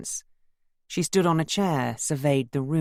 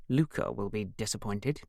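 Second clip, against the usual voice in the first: lower, softer, knowing tease; meaningful smirk; 4 semitones lower, 5 dB quieter than usual. A narrator's reading. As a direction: tease pointedly through a smirk. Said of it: "This last with a meaningful smirk".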